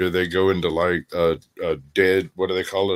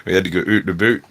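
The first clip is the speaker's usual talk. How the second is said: mock Canadian accent; much faster than usual. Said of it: "Canadians tried to play soccer".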